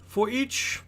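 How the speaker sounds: lightly voiced, self-important city merchant; explaining merchant. Merchant to newcomer on running the city, lightly lecturing.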